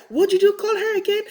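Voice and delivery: high, quick schoolmate's question; mock disbelief. Kids baiting her to say it again, mock disbelief. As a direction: fire it off high, as if astonished.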